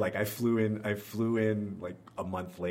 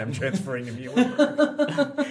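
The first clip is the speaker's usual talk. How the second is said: slower, laughing through the news; giggly, slow reveal of a done deal; laughing announcement; 7 semitones higher, 9 dB louder, slower than usual. Retold or imitated. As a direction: break into a laugh as you drop the news. Announcing surprise decision to parents.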